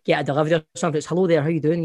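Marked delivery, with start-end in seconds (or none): none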